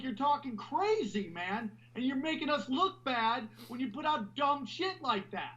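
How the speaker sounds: high, exasperated scolding voice; imitating an exasperated conspiracy buff; mock scolding. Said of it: "And you're like".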